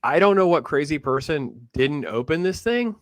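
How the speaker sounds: normal talking voice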